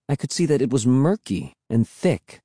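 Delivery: ordinary delivery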